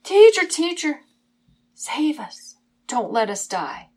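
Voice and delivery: loud high cry sinking into pleading; frantic calling out. Acted. Disciples powerless in the storm, frantic calling out. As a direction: call out urgently, then let the voice drop. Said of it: "They called out frantically".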